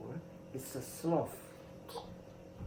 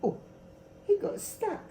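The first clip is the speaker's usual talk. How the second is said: lightly voiced monkey; 13 semitones higher, 3 dB quieter than usual. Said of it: "They go".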